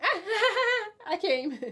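high, laughing "Ah, I came"; mock fake orgasm. To a guy she won't date, mock climax, laughing. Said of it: "I'ma be like".

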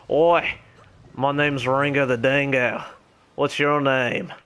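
hammed-up, terrible Australian accent; Ringo the Dingo. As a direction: introduce yourself in a broad, badly faked Australian accent. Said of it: "my terrible Australian accent".

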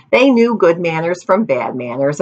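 usual speaking voice

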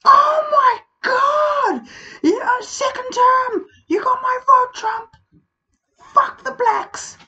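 mock the adoring crowd, whining and screaming; mocking Trump fans.

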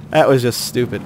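muttered repeat. Real, not acted. Gamer repeats complaint to himself, muttered repeat. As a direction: mutter "That was just stupid." under your breath.

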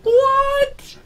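high, loud cry of disbelief; shocked cry. Real, not acted. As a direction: yelp it out in shock. Shocked reaction to losing game.

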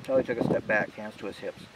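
ordinary delivery

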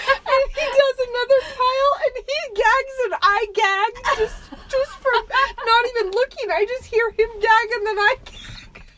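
high, laughing and half crying; can barely get it out for laughing; telling it through helpless laughter; laughing through the story. Real, not acted. Laughing while retelling gagging.

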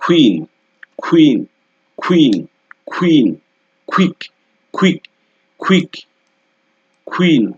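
কুইন, কুইক called out high and loud; qu example words. Shown as a demonstration. Teacher loudly drilling qu words to students. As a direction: sing out কুইন, কুইক with a strong kw. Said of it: "example যদি দেই".

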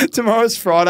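high giggling through the words; laughing. Real, not acted. Cohost cracking up at the tip, laughing high.

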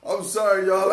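normal talking voice

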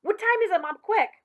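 high, loud, urgent; boy panicking about the time; frantic question. Acted. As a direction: demand it in a panic. Billy frantic asking time.

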